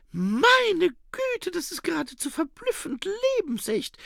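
very high female exclamation; Saccharissa. Acted. Woman reacts to comic vegetable. (sehr hoher, erstaunter Ausruf)

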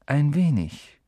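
musing centaur, a touch mournful; understated, wistful echo; melancholy echo. A narrator's reading. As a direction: repeat it thoughtfully, with mild melancholy. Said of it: "Er hatte eine melancholische Stimme".

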